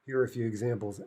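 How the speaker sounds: usual speaking voice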